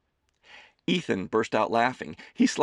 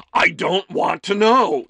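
higher, loud, slow repeated protest; louder protest; 10 semitones higher, 11 dB louder, slower than usual. Acted. Son begging his mother to stop, louder on the repeat. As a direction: shout it the second time. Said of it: "pushed his chair away".

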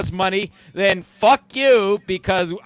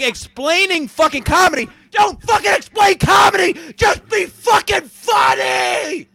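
ranting shout; 10 semitones higher, slower than usual. Real, not acted. Host ranting about comedy, high shout. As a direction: hammer it home at full voice. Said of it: "That was the greatest fucking rant".

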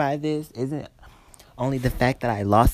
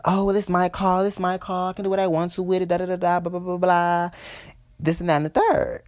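higher-pitched dismissive imitation; mocking rude customer; 6 semitones higher than usual. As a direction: mimic her insisting, rattling on in a raised pitch.

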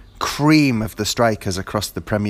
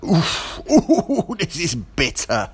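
pained laugh; 8 semitones higher, 3 dB louder, much slower than usual. Real, not acted. Fan laughing at his bitterness over the goalkeeper leaving. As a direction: laugh off the sting.

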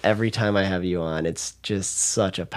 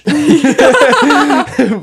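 high, loud cackling laugh; burst of laughter; 11 dB louder than usual. Real, not acted. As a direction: let a big laugh burst out. Laughs at her own joke.

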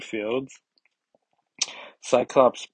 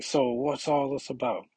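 retry of the voice; 7 semitones higher than usual. Retold or imitated. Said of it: "that's a horrible voice".

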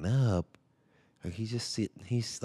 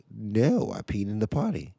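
put-on a touch of kid voice, higher and insistent; son's denial; 4 semitones higher, 6 dB louder than usual. Son insisting to dad he used the potty. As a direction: play the boy sticking to his story.

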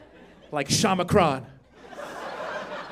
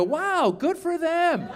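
high, slow, drawn-out marvel; detached admiration; 9 semitones higher, much slower than usual. Retold or imitated. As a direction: coo it slowly at others' good luck. Acts out admiring others on news.

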